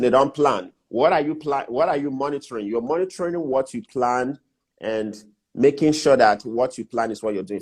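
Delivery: ordinary talk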